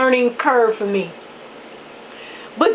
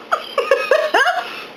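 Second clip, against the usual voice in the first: high, happy little giggle; delighted giggle. Real, not acted. Giggling with joy over her homemade sushi.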